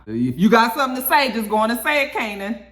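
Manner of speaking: real exasperated call-out; scolding the screen. Real, not acted. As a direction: dare him to say it. Viewer scolding Kanan at screen.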